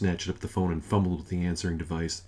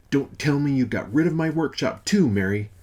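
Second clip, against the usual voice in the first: alarmed accusation; 7 semitones higher, 5 dB louder than usual. Acted. Father fearing loss of workshop.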